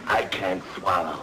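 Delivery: low, feeble invalid's croak; hoarse, suffering. Acted. Patient refusing water from his wife, hoarse and feeble. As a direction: croak it out with a sore throat. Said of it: "You don't sound so hoarse".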